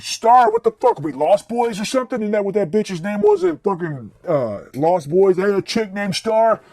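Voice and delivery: high mocking disbelief; incredulous. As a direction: scoff at the weird question. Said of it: "It's like".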